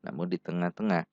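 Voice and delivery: usual speaking voice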